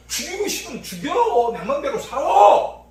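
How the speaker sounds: loud, defiant imitation of a sinner; mock carefree boast. Preacher mocking sinners, loud defiant boast. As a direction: shout it out like you answer to no one.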